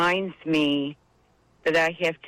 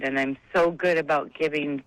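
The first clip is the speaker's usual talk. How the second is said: ordinary talk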